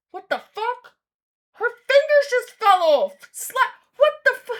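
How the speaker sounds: startled yelling; shocked shout. Real, not acted. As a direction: blurt it out in real horror. Shocked reaction to gore.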